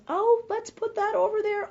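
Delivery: very high, airy put-on voice; soul's voice. The soul setting pain aside, high evasive voice. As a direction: pitch it way up, lightly dismissive. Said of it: "that my soul says".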